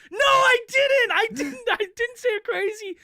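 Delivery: defending himself to the furious caller, hammed-up panicked denial; mock panicked denial. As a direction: protest in a high panic. Own frantic denial, high.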